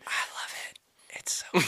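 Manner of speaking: breathy whisper of love; sweet nothings. Pretend. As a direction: whisper it lovingly to the app. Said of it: "I'm whispering sweet nothings into your app".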